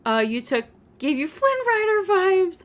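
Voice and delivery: ordinary delivery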